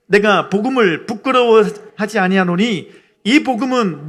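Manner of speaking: loud, emphatic verse reading; scripture read aloud. Real, not acted. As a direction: lead the congregation through the verse. Reads Bible verse aloud with congregation.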